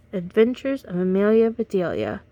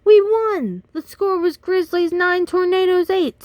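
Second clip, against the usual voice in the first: excited; 10 semitones higher, 7 dB louder than usual. Acted. Team celebrating the victory.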